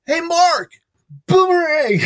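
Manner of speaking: high, loud call across the hall. Retold or imitated. Girl calling across the catwalk, loud and high. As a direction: shout it cheerfully across the catwalk.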